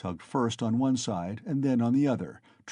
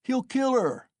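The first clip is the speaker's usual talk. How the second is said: very high alarmed cry; shouted; 13 semitones higher, 4 dB louder than usual. A narrator's reading. Onlooker crying out as the shaft comes down, alarmed. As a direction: scream the warning. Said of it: "was shouted round him".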